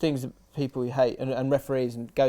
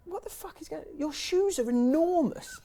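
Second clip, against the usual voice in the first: mates mocking; 13 semitones higher than usual. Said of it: "they go, like".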